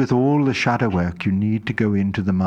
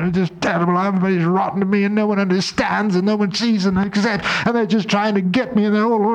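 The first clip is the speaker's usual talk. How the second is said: high, whining voice full of self-pity; whiny self-pity; 9 semitones higher than usual. Acted. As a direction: whine like a wounded martyr feeling sorry for herself. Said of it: "It's recognizing".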